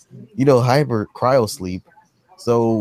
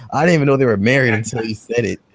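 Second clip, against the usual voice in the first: ordinary talk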